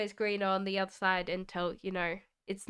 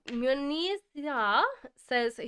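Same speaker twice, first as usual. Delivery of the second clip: ordinary talk, nothing marked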